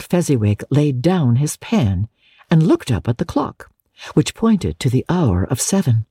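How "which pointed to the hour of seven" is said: ordinary delivery, nothing marked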